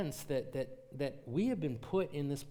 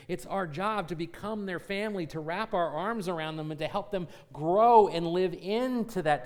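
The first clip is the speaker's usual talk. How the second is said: louder, higher, driving sermon climax; full-force preaching; 7 semitones higher, 10 dB louder than usual. Acted. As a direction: preach it out to the congregation with rising fire. Pastor builds into full preaching.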